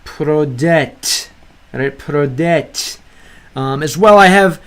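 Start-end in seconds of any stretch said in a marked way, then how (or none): 0.0-1.3 "Проdet" said slowly with stress on the last syllable; stress demonstration. Shown as a demonstration. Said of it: "tell you where the stress lies". 2.0-3.0 "Проdet" with the last syllable stressed; careful teaching "Проdet", weight on the final syllable; stress demonstration. Shown as a demonstration. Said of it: "tell you where the stress lies".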